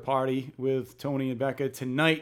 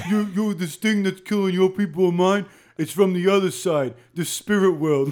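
higher, louder Jamaican movie-character voice; Jamaican accent; 3 semitones higher, 4 dB louder than usual. Acted. Podcaster voicing the movie's Jamaican in the alley, Jamaican accent. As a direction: put on a hammed-up Jamaican accent. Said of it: "you could do the Jamaican in the alley".

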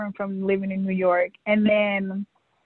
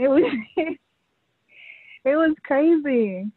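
ordinary talk

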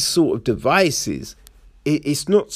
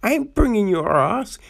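high, firm no-nonsense refusal; hammed-up refusal, voice up high; mock indignant refusal; 8 semitones higher than usual. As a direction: put your foot down, playing the boyfriend. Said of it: "instead of being like".

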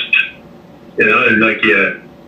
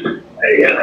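usual speaking voice

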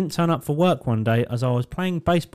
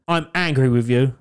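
a hint of a sulky toddler voice; lightly voiced toddler. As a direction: play the cross little boy, lightly. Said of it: "He went".

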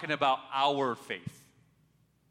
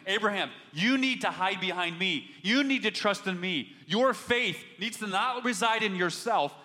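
louder, higher preaching push; full-force preaching; 5 semitones higher, 3 dB louder than usual. Acted. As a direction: drive it home from the pulpit. Preacher rising again.

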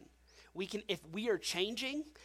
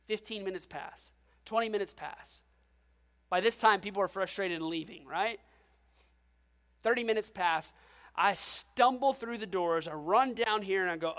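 ordinary delivery

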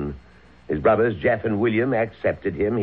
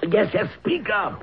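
higher, impatient urging; impatient prodding; 13 semitones higher than usual. Acted. Father pushing his stammering son to speak. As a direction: hurry him along sharply.

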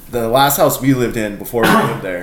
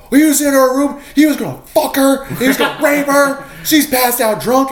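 very high-pitched, frantic mock report; outraged yelling; 13 semitones higher, 5 dB louder than usual. Friends report intruder in her room, mock outrage. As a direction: imitate friends yelling out the accusation.

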